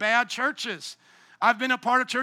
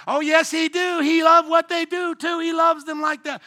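high, loud yelled retort; yelling back; 6 semitones higher, 6 dB louder than usual. Retold or imitated. Protester at the pride parade yells back at preachers, shrill and loud. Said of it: "she yells back".

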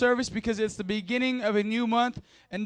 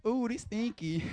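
softer, put-on reaction to a stink; mock disgust; 2 semitones higher, 6 dB quieter than usual. Preacher pretending to sniff a smelly chair, mock disgust. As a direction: react to a bad smell in jest.

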